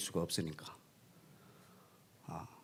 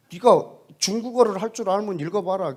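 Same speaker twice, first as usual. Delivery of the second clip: louder, higher, daring tone; testy challenge; 8 semitones higher, 8 dB louder than usual. Retold or imitated. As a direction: throw down the dare. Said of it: "내가 또 성질 나 있기 때문에". (도발하듯 맞서며)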